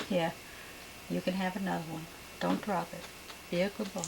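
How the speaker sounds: soft, tender pet talk; gentle painting instructor. Real, not acted. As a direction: coax him kindly, quietly.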